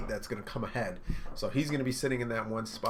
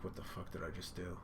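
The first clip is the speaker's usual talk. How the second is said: much softer, lower inner voice; stunned murmur; 3 semitones lower, 11 dB quieter than usual. Retold or imitated. Sanji quietly horrified at what he did. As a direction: say it to yourself in shock.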